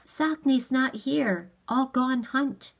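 lightly voiced girl speaking halting English; girl's halting English. A narrator's reading. Girl answers in limited English.

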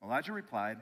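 ordinary talk

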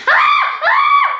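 put-on shrill high baby wail; baby screaming. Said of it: "the baby is screaming".

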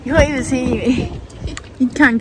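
ordinary talk

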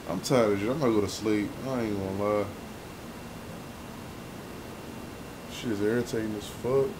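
real weary resignation; tired and deflated. Real, not acted. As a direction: let the energy drain out, fed up. Exhausted, giving up on recording.

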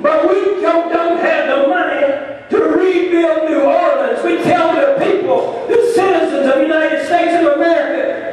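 fiery rally preacher, shouting the refrain. Acted. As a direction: whip up the crowd, call and response. Union rally, preaching at full force.

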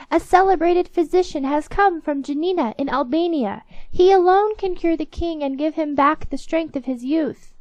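high, loud public announcement; crying out. A narrator's reading. Old woman demonstrates the palace-door cry, loud high proclamation. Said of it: "cry out".

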